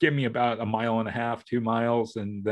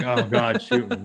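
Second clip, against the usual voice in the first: mock exasperation; 3 dB louder than usual. Husband worn out by boat bickering, playfully exasperated. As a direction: throw up a mock plea for mercy.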